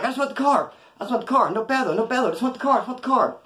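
high, urgent repeated plea; pleading. Retold or imitated. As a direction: beg them to back off while dodging. Pleading while dodging shovels.

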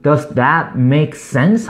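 very slow with a rise at the end; slow demo question. Shown as a demonstration. Teacher models checking if the listener understood. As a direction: ask it slowly, checking understanding. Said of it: "영어로 어떻게 할 것인가?".

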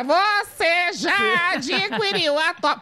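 hammed-up commercial announcer; high, slow, mock ad read; TV ad presenter.

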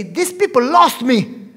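loud, drawn-out cry of dismay; loud protest at being left out; alarmed outcry. Retold or imitated. Panicked reaction on reading exam list.

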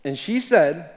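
ordinary talk

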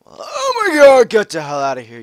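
very high, loud shocked scream; frightened yell at a creeper; panicked scream. Real, not acted. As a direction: shriek it as the creeper appears. Creeper surprise.

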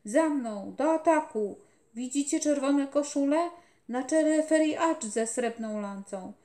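put-on loud feverish child's shouting; delirious battle cry. A narrator's reading. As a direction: lead the charge in fever. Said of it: "ochrypły dziecinny głosik".

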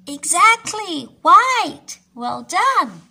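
bright loud praise; excited praise. Acted. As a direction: cheer the right answer. Praising child's answer.

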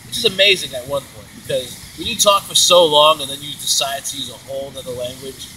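ordinary talk, nothing marked

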